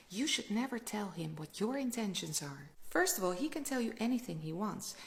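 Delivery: ordinary talk, nothing marked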